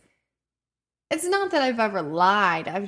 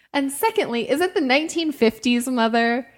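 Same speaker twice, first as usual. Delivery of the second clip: sassy retort; 5 semitones higher, 5 dB louder than usual. Retold or imitated. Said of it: "And I'm like".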